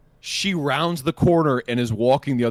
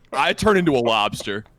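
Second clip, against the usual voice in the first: ordinary talk, nothing marked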